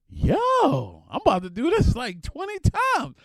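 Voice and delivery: high, loud giddy excitement; thrilled yell, drawn out at the end; excited. Retold or imitated. Excited at unlimited spending.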